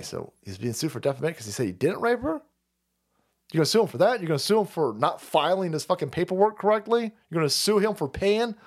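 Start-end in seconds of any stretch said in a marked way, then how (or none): none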